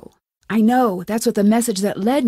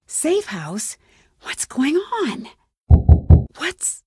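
hushed, uneasy questioning; uneasy confusion; 4 semitones higher, slower than usual. Acted. Alarmed as something unexplained happens.

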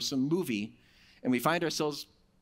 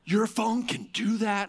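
amazed; 6 semitones higher, slower than usual. Retold or imitated. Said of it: "Beth's looking at me like".

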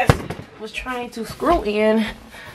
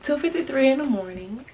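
tired; 2 semitones lower, 8 dB quieter than usual. Real, not acted. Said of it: "and I am tired".

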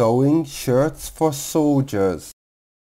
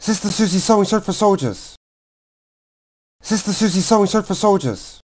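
tongue twister at top speed; very fast; 5 semitones higher, much faster than usual. Shown as a demonstration. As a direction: rattle it off as fast as possible. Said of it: "faster and faster".

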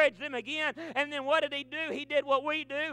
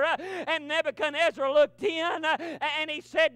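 ordinary talk

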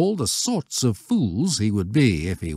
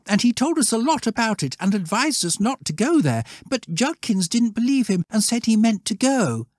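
put-on higher boyish voices against a brisk master; schoolboys and masters; 13 semitones higher than usual. A narrator's reading. Master questioning scouts about Judkins, higher anxious voices. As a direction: voice the worried exchange quickly.

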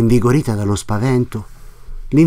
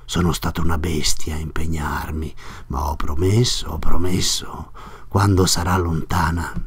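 whispered private thought, much softer; inner thought; 7 semitones lower, 5 dB quieter than usual. Acted. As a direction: hush it as if thinking to himself. Innominato's whispered inner monologue.

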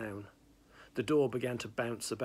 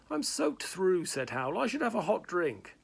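higher, louder whine; whiny Hal; 8 semitones higher, 4 dB louder than usual. Acted. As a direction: beg pathetically. Hal begs for a drink.

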